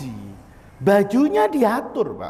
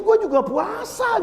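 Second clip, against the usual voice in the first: slow, much higher comeback; mock retort from a fasting cop; policeman's dry retort; 11 semitones higher, slower than usual. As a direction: toss it back at him, slowly and high. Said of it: "Kata polisi".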